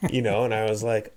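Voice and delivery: usual speaking voice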